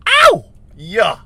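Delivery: very high, loud celebratory yell; excited whoop. Real, not acted. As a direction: whoop and holler for a treat. Celebrates getting a milkshake.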